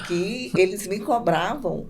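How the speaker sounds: ordinary delivery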